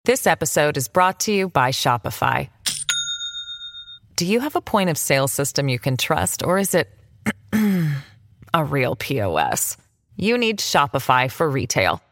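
ad read. Acted. Read ad spot.

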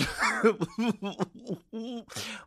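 high-pitched giggling fit; real laughing fit; helpless laughter. Real, not acted. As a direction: crack up in a long high laugh. Cracking up at her own joke.

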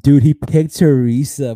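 loud, slow shocked reveal. Real, not acted. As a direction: drop the news with disbelief.

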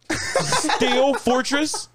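high, very slow laughing question; laughing disbelief. Real, not acted. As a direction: stretch it out through laughter. Laughing incredulous repeat.

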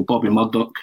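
ordinary delivery

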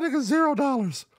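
high put-on voice mocking the friend's verdict; mocking imitation.

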